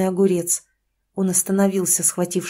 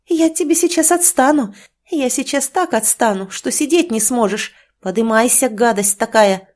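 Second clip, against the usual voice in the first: furious scolding; 4 semitones higher than usual. Acted. Mother scolding drunk son.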